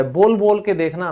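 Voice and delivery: ordinary talk, nothing marked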